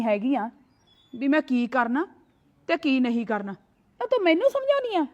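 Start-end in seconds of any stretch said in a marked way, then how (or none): 4.0-5.1 mocking. Sister-in-law mocks being lectured.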